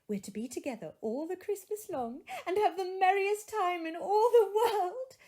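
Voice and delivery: put-on high, laughing, gushing child; excited little girl. A narrator's reading. Little Fan gushing to her brother about Christmas, high and laughing. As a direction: let the joy spill over as the little girl.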